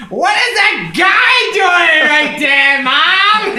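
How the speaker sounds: put-on high, slow, loud child calling out to her mom; little girl's voice. Kid in the bank calling to her mom, high and loud. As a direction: squeal it like a curious little kid. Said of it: "all I can hear is".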